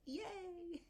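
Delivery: high, squealed little yay; mock cheer. Telling viewers about an easy job, a high mock cheer. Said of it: "and I was like".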